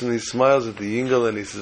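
ordinary delivery

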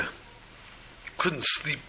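hushed, sad. A narrator's reading. Sombre telling of father's worry.

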